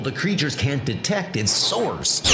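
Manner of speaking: ordinary talk, nothing marked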